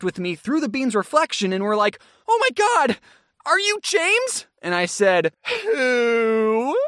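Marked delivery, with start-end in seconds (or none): 2.3-4.5 high-pitched gushing fan impression; starstruck fans. Fans recognising a celebrity, excitedly imitated. 5.6-6.8 drawn-out, rising mock-innocent question; feigned ignorance. Recognised celebrity answering fans, playing dumb.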